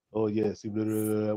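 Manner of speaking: very low, soft mock moan; low put-on deep murmur. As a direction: drop deep and quiet, imitating her in bed. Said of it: "with that deep voice".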